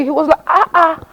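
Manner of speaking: ordinary talk, nothing marked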